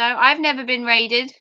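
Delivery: ordinary talk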